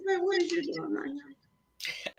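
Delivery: high, soft, drawn-out question; acted bewilderment. Woman retells asking the boy what he's doing, bewildered. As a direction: ask it slowly in a small high voice.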